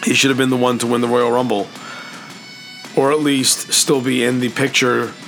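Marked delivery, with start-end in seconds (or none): none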